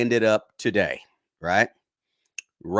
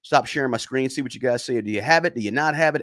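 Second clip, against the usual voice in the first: ordinary delivery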